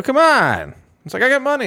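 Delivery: high, loud whiny mock plea; pleading buyer. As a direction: whine like a customer who won't take no. Buyer pleads in acted quarrel.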